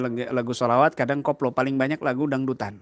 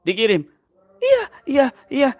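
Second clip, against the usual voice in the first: jinn speaking; 11 semitones higher, 6 dB louder than usual. Retold or imitated. Possessed driver's jinn answers.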